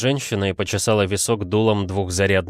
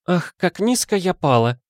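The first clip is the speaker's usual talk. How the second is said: high, theatrical lady's lament; sighed lament; 10 semitones higher than usual. A narrator's reading. Madame surrendering her papers, melodramatic sighed lament. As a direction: sigh it out in a high voice. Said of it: "выдохнула мадам Дюфренуа".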